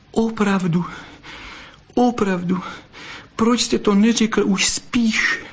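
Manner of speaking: high, tearful vixen voice sinking to a whisper; blurted out tearfully. Acted. Vixen blurts her reproach to the fox, tearful and high. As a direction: blurt it out through tears. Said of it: "vyhrkla a utírala si čumáček".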